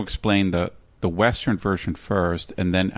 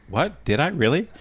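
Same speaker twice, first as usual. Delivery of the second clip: high, startled disbelief; retelling his startled reply to his sister; surprised; 7 semitones higher than usual. Retold or imitated. A man retelling his surprise when his sister pointed out his hum, pitched high. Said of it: "I'm like".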